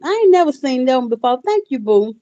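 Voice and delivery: bright quick thank-you; excited thanks. Real, not acted. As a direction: keep the delight going. Thanking a viewer.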